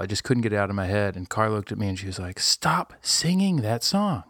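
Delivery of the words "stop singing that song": imitated exasperation. Retells partner ordering him to stop.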